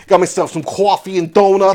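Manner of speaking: broad New York vowels; hammed-up New York accent. Playing a New Yorker with coffee, New York accent. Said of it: "New York City".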